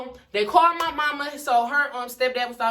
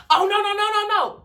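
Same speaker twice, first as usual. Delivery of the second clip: high, quick correction of herself; catching a mistake; 9 semitones higher, 4 dB louder than usual. Real, not acted. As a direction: stop yourself mid-story. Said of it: "I lied".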